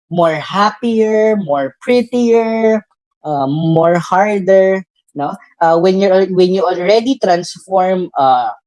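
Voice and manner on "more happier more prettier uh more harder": slow, exaggerated quoting of the error; slow mock quote of bad grammar; mock wrong usage. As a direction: drag out each wrong phrase, mocking. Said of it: "people are saying".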